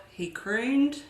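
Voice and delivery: ordinary delivery, nothing marked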